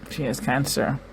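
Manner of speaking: ordinary delivery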